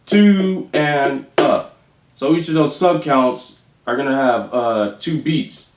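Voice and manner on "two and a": drawn-out One and a; counting a slow triplet. Shown as a demonstration. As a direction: stretch each subcount evenly. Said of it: "One more time".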